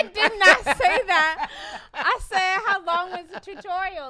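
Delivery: loud, high, exasperated denial; loud protest. Real, not acted. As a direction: shout it down, indignant. Accused, insists on his words.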